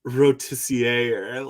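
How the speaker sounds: "rotissier" ending in -ee-ay; "rotissier" with a French-like ending; French-style guess. Shown as a demonstration. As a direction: say "rotissier" slowly as ro-tiss-ee-AY. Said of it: "it's not even, like".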